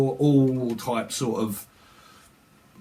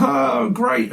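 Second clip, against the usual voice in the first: higher and louder, gushing; bright exclamation of delight; excited; 10 semitones higher, 5 dB louder than usual. Retold or imitated. Contestant picked on the blind date, in a higher louder gush.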